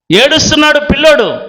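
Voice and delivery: normal talking voice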